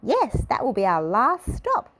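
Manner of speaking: high cheery tour-leader voice; cheerful Little Miss Fun. A narrator's reading. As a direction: answer like a cheerful guide. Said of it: "said Little Miss Fun".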